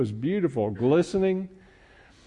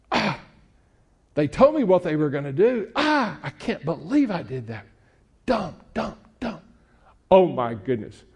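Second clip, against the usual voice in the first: put-on whiny, higher teen lament; melodramatic teen; 4 semitones higher than usual. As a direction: overdo the teenage despair. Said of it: "I'm being silly".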